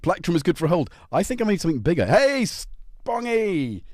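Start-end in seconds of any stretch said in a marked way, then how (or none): 2.1-3.8 high, slow, drawn-out hello; a drawn-out high call to a regular in the chat; sing-song greeting. Real, not acted. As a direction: stretch it out high to greet a friend.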